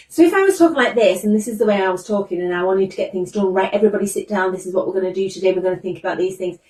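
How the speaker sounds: fast, breathless rush, pitch pushed up at the start; rushed anxious teacher. Shown as a demonstration. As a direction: gabble the instructions like a stressed teacher hurrying the class. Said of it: "you can already feel the anxiety coming from me".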